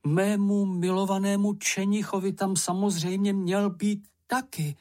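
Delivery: high, lightly voiced mother's exclamation; exclaiming mother. A narrator's reading. Mother remembering the inscription for Čenich, high exclamation. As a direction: raise the pitch, a touch of alarm. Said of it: "vykřikla maminka".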